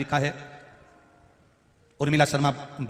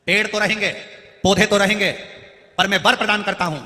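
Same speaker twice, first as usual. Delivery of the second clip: raised, louder voice for Ganesh's decree; proclaiming a boon; 5 semitones higher, 6 dB louder than usual. Retold or imitated. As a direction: declare it high and firmly, as a retold divine pronouncement. Ganesh proclaims boon in retold story. (Ganesh जी का ऊँची आवाज़ में वरदान सुनाना)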